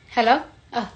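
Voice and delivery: ordinary delivery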